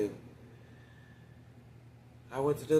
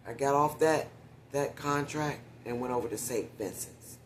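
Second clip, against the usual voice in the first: ordinary delivery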